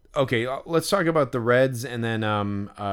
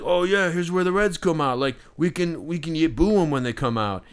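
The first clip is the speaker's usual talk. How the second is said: lightly imitated drunk fan, pitched up; a hint of a rowdy drunk; mock drunk heckler; 7 semitones higher, 3 dB louder than usual. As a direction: play a loudmouth rallying others to heckle. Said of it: "these fucking drunk people".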